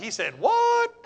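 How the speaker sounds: high, disbelieving squeak on what; incredulous. Retold or imitated. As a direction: sound like you can't believe your ears. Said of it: "I'm like".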